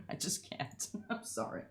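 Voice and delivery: unvoiced giggle through the words; giggling apology, barely voiced; whispered laughing. Real, not acted. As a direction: laugh it off under your breath. Laughing after the gush.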